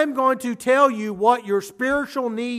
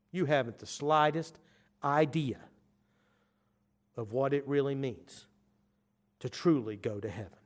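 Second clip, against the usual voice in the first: hushed; 8 semitones lower, 9 dB quieter than usual. Acted. Jesus' quiet solemn close.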